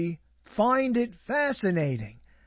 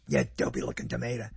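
quick, much lower mock neighbor voice; neighbor's put-down; 7 dB quieter, much faster than usual. Recalling the neighbor's insult, low quick mock voice. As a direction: toss it off low and fast like the neighbor. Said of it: "That's how he said it".

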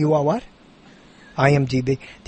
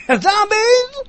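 high, loud exclaimed question; really loud; 6 dB louder than usual. Shown as a demonstration. Host echoes the punctuated film title, really loud. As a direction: belt it out with the pitch shooting up. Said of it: "You have to say it really loud".

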